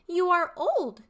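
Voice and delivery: high, loud, eager youth; young man's voice. Shown as a demonstration. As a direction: call out to the old father, high and loud. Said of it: "three distinct character voices".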